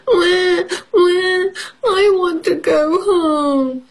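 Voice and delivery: high, slow whimpering plea; baby mouse crying to go home; mock baby crying. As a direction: wail like a lost little mouse. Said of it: "cries Baby Mouse".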